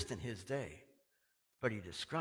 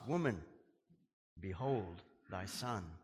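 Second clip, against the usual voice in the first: slow, soft and lower; tender words; 7 semitones lower, 5 dB quieter than usual. Retold or imitated. Jesus to his mother, slow tender words. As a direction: say it gently from the cross.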